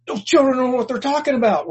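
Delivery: high, scornful put-down; mock haughty ruler; dismissive rulers. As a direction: sneer at the children. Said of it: "the religious rulers were saying".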